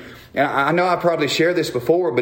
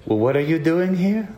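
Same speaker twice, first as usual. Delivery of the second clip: lightly imitated Nordic doctor; Scandinavian accent; 4 semitones higher than usual. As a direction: put on a slight Scandinavian accent. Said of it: "You know, in that, that accent".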